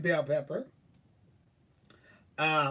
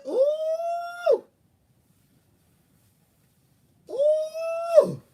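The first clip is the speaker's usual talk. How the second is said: high delighted whoops, much louder; excited squeal; 8 dB louder than usual. Real, not acted. Remembering how good it tasted, a loud high squeal. Savouring her pepper steak.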